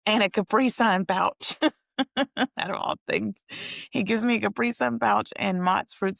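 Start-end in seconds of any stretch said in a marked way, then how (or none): 0.0-3.4 laughing in disbelief. Real, not acted. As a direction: giggle through the words. Laughing at absurd snack.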